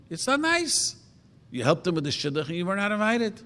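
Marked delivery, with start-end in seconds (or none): 0.1-1.0 "nice" pitched way up, plaintive; high-pitched it's not nice. Shown as a demonstration. To the questioner, a high plaintive protest. Repeating point, pitched up sharply.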